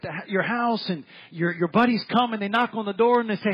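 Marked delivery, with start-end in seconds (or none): none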